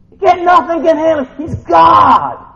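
loud, high preaching; forceful, fervent declaration; emphatic proclamation. Real, not acted. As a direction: build to a shouted climax. Preaching climax on God's might.

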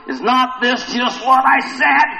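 high, slow, indignant protest; angry complaint. Acted. Preacher plays Jonah praying to the Lord, high angry complaint. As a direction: stretch it out as a peeved complaint. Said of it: "he's still angry".

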